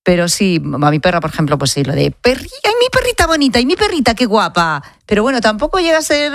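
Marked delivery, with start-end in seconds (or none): 2.1-4.8 put-on high sing-song baby talk; baby voice for dog. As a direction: coo at your dog like a baby. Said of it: "si le hablas con voz de bebé".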